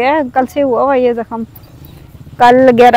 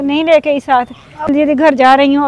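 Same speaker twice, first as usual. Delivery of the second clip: ordinary talk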